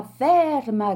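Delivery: a smaller, falling repeat of the yell. A narrator's reading. Gentleman calling after his nose, fading shout. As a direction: let the second call drop, already losing hope. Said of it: "gridò il signore".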